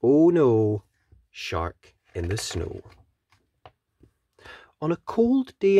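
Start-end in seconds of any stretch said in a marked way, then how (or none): 1.3-2.8 lower, softer title; quiet. Acted. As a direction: say the title low and ominous. Title repeated low.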